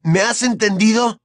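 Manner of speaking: high, loud, angry demand; escalating threat. Acted. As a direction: force an answer out of him.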